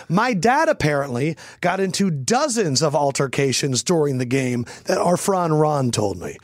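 ordinary talk

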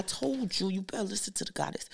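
breathy teasing whisper. Real, not acted. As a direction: whisper it like a secret warning. Playful warning to listeners.

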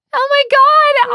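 loud, high, slow oh my God; real shock; shocked exclamation. Real, not acted. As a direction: gasp it out in disbelief. Reacting to the crossed-out script.

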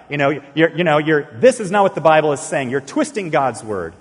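acting out an argument, pressing the point; arguing. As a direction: push back firmly, as if retelling a dispute. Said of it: "I was arguing with them".

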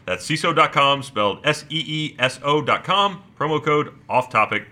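brisk, confident sponsor read; podcast promo delivery, settling at the end. Acted. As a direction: reel off the offer and spell out the web address. Reads the sponsor ad copy.

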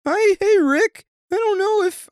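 very high, nervous Morty stammer; squeaky anxious teen voice. Retold or imitated. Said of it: "that was kinda good".

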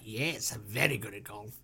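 low, quiet self-satisfied voice; boastful Pharaoh. Acted. Pharaoh boasting about golf, low soft smugness. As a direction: brag softly in a low register.

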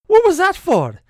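high, loud indignant yelp; a shocked husband's high, loud squawk; startled yelp. Retold or imitated. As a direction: yelp it out, caught off guard. Father startled by a smack.

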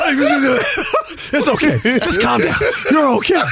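laughing, soothing high reply; calm her down with a chuckle; calming wife. Retold or imitated. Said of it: "I said".

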